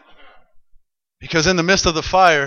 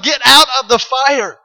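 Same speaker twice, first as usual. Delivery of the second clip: raised, high mock yell; 7 semitones higher, 4 dB louder than usual. Preacher retells the king yelling into the furnace, moderately raised. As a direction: call it out, short of a full shout. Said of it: "he's yelling".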